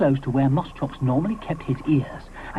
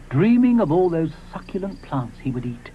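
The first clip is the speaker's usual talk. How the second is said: ordinary delivery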